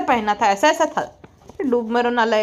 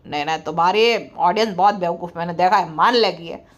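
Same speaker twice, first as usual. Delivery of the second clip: low, droning put-on man's voice; mock deep voice; 8 semitones lower than usual. Mocked husband tells partner to credit his recharges, low smug voice. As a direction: drop the voice low and lecture smugly.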